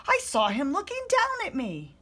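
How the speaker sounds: loud alarmed shout. A narrator's reading. Monster tells mommy what he saw, loud alarmed. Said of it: "he shouted".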